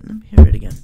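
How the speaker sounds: ordinary talk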